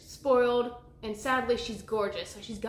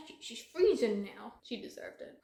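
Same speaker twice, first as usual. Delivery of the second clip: usual speaking voice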